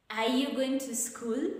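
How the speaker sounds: "school" swept upward as a yes-no question; rising intonation. Shown as a demonstration. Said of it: "will take the rising intonation".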